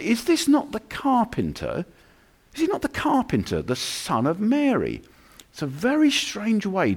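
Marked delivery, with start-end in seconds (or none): 0.0-5.0 questioning townsfolk. Acted. Nazareth townsfolk questioning Jesus.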